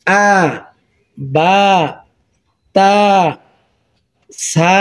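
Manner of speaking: আ, বা, তা, সা as separate drawn syllables with pauses; sounding out syllables. Shown as a demonstration. Teacher modelling bare sounds for students, paused syllables. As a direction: say each sound alone, then stop. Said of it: "এবার আপনি উচ্চারণ শিখবেন কিভাবে".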